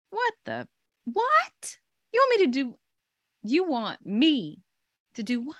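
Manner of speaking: high incredulous squeal. Retold or imitated. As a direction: act out total disbelief. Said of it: "I'm just like".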